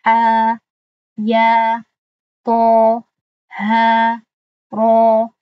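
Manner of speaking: loud, held Quranic letter names; loud tajweed recitation of the letters; recited letter names. Shown as a demonstration. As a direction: chant each letter, stretching the vowel two beats. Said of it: "به دو حرکت دراز کرده شود".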